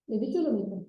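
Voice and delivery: ordinary delivery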